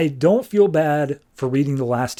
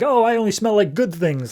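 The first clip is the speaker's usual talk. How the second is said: mock protest; 7 semitones higher than usual. Voicing Mouse refusing to wash.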